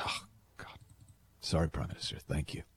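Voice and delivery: hushed, embarrassed half-whisper; sheepish apology. Retold or imitated. Embarrassed aide backing out of the meeting, hushed and sheepish. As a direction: back out apologetically, breathy and soft.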